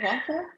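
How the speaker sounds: WATER with an open "ah" ending and no R; Jamaican pronunciation. Shown as a demonstration. Speaker asking why she should change her word, Jamaican pronunciation. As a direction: say WATER the Jamaican way, final R dropped. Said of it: "nothing wrong with saying water".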